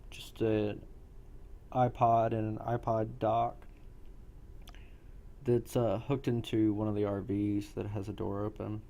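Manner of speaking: ordinary talk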